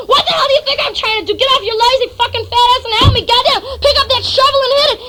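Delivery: fast, high-pitched angry yell; screaming back. Retold or imitated. Friend demanding help with the cat, screaming back. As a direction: fire back a rapid, furious acted tirade. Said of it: "He's screaming back!".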